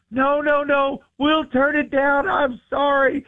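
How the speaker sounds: hammed-up flustered backpedal; host mocking his own flustered apology. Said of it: "I'm like".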